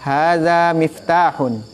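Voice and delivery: slow Hadza with a gentle dh; teacher repeating the dhal model; soft dh. Shown as a demonstration. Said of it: "Hurufnya zai jadi nyonya zal".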